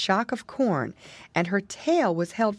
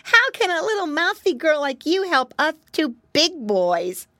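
put-on high, louder pig-boy voice; cocky little piglet, pitched up; scoffing piglet; 10 semitones higher, 5 dB louder than usual. Flop doubts the mouse could help, high scoffing voice.

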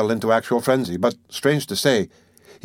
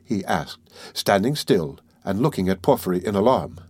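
ordinary delivery, nothing marked

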